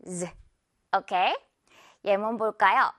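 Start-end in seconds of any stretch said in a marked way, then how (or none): 0.1-0.3 Z as a buzzed "zzz", not the letter name; a short isolated "zzz" for Z; voiced z sound. Shown as a demonstration. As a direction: buzz the Z sound alone. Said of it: "여기 마찬가지로 S 이 부분".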